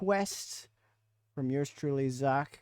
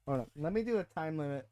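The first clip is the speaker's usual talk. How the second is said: normal talking voice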